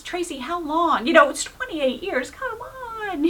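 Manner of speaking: slow, voice breaking into a cry; moved to tears as she says it; tearful plea. Real, not acted. God's voice pleading, speaker moved.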